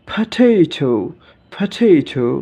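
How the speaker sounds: "পটেটো" as pha-they-tho, falling at the end; aspirated P. Shown as a demonstration. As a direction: puff the p and both t's of "পটেটো". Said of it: "যেমন". ("পটেটো" মহাপ্রাণ "ফাঠেইঠো" উচ্চারণে)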